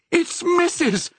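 very high, drawn-out exclamation; high-pitched, slow cry of amazement; delighted cry. A narrator's reading. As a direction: cry out in joyful recognition. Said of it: "cried Missus Dearly".

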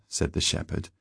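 ordinary delivery

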